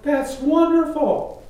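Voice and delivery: high, loud, drawn-out enthusiasm; retold delight. Hearing of Bible studies for family, delighted exclamation. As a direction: exclaim with joy, stretching it out.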